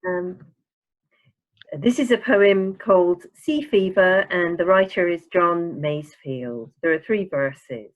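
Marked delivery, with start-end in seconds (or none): none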